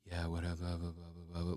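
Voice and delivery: a hint of easygoing calm, dropped low. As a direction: shrug it off softly. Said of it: "he was just like".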